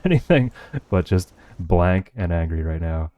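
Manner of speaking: ordinary delivery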